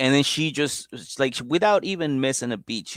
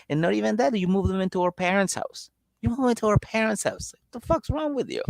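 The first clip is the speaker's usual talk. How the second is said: much higher, exasperated mock sister; scolding sister impression; 11 semitones higher than usual. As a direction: scold him like a fed-up big sister. Sister scolding brother, retold.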